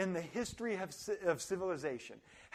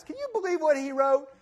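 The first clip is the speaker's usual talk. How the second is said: higher, louder mock outrage; loud indignant gossip; 7 semitones higher, 8 dB louder than usual. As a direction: act scandalised at a post. Mimicking outraged Facebook reader.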